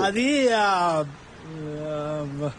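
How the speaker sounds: drawn-out ums, fading off; hesitant stalling. Acted. Son dodges father's question on the phone, hesitant stalling. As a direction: stall for time, voice trailing away.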